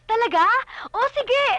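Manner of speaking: high, loud burst of delight; thrilled, exclaimed; delighted surprise. Acted. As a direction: jump at it eagerly, voice soaring. Delighted at parents' agreement to fiesta.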